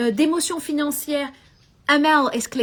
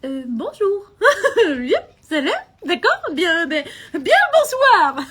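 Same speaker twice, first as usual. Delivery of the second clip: awkward greeting, rising high and breaking into laughter; embarrassed, giggly, drawn-out good evening; flustered laughing reply; 6 semitones higher, 7 dB louder, slower than usual. Retold or imitated. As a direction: stumble over hellos like you don't know them at all. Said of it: "Et moi, je me dis".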